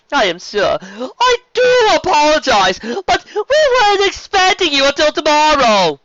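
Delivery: loud, high, slow and grand; flamboyant sea serpent. Acted. Serpent duke welcoming the dragon, effusive and loud. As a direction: proclaim with drawn-out, high-pitched flourish.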